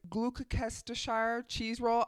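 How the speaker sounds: Gloucestershire said slowly, sounded out as Gloo-kes-ter-shire; unsure attempt. Real, not acted. Vlogger bravely mangling the place name Gloucestershire. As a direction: carefully stumble through "Gloucestershire cheese roll". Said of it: "I'm gonna just butcher this".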